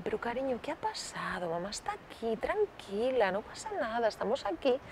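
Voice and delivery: very soft, gentle reassurance; tender, hushed and calming; soothing parent. Acted. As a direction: comfort a small child in a hushed voice. Parent comforting child after tantrum.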